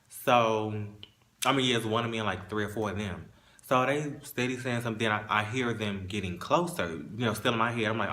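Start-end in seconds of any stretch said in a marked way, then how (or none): none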